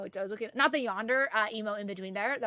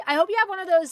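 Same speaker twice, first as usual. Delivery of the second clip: ordinary delivery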